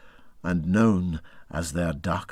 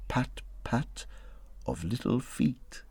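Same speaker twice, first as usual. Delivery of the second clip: hushed pattering; 12 dB quieter than usual. A narrator's reading. Narrator evokes distant pattering.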